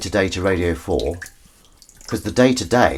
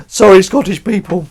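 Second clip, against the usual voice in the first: high, hammed-up shouted apology; mock loud apology; 11 semitones higher, 8 dB louder than usual. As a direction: call the apology out to a whole nation. Repeats apology, loudly hammed up.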